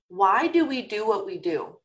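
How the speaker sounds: ordinary delivery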